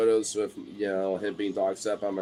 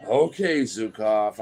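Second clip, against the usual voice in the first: mock exasperation; 5 dB louder, slower than usual. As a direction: give in to the pushy dog, loudly. Mock giving in to dog.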